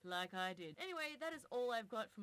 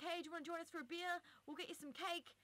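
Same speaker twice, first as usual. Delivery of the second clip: mock friendly invitation; 8 semitones higher than usual. Retelling the bald men's invitation.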